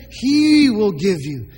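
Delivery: "he" stretched out and emphasised; stress on HE. Shown as a demonstration. Preacher showing who gives the desires, stressing "he". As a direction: lean on "he" as the one who gives. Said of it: "Who gives you joy?".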